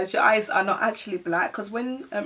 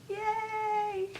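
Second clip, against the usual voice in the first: cheer; 12 semitones higher, 4 dB quieter than usual. Real, not acted. Cheers after declaring she's Ghanaian.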